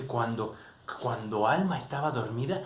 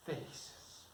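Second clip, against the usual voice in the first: soft whisper on the last word; whispered ending; 15 dB quieter than usual. A narrator's reading. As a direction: breathe the happy ending. Story's tender ending. (final susurrado)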